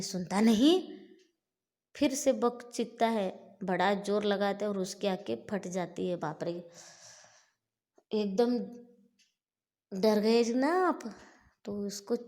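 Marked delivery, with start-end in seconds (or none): none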